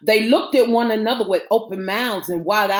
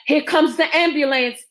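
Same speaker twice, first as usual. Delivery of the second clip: raised, higher-pitched announcement; announcing; 6 semitones higher, 5 dB louder than usual. A narrator's reading. Woman rushing upstairs with news, announcing. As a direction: call out the news urgently. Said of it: "Eve Maybell announced".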